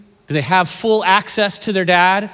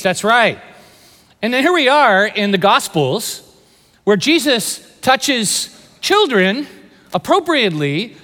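normal talking voice

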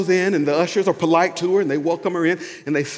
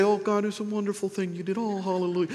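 high, soft, timid little thanks; meek praise; 4 semitones higher, 8 dB quieter than usual. Acted. Contrasting timid thanks with bold public praise, meek and soft. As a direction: murmur polite praise in a small high voice. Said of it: "He didn't just say".

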